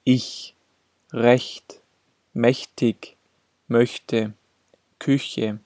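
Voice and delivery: careful word list with front ch in "recht" and "möchte". Shown as a demonstration. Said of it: "Es wird gesprochen nach hellen Vokalen".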